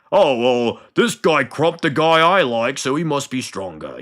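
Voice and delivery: a hint of a dumb orc; lightly voiced brutish orc; dim orc voice. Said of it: "orcs are kinda stupid like that".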